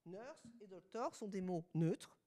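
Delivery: ordinary talk, nothing marked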